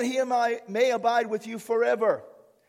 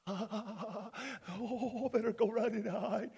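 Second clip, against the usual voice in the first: mock frightened devil; 12 dB quieter, much slower than usual. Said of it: "what does he see".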